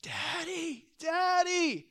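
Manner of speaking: high, strained cry for Dad; child's scream. Retold or imitated. Little son on the doctors' table calling for his father, screaming. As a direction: imitate a terrified little boy's scream. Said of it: "he just lets out this scream".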